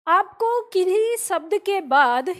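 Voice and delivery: ordinary talk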